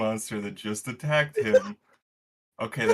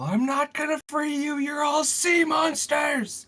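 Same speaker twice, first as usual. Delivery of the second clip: Chuck yelling; 5 dB louder than usual. Acted. Player as Chuck refusing the sea monsters, yelling. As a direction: shout it in character, pitched way up. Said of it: "Chuck would probably yell".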